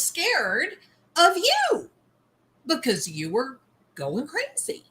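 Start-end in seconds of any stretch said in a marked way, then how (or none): none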